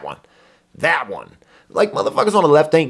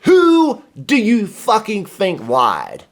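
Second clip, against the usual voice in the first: slow angry question; 6 semitones higher, 4 dB louder, much slower than usual. Real, not acted. Angry rant at the clip.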